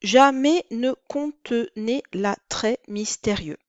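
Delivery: slow, measured poetic reading; slow verse recitation. Shown as a demonstration. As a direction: read the line out like a poem. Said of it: "Par exemple".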